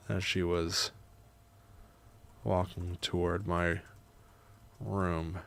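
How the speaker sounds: measured, soft reading with long pauses; memoir narration. A narrator's reading. Writer narrating grandma's visit, measured memoir reading. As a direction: read it quietly, phrase by phrase.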